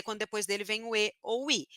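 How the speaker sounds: ordinary talk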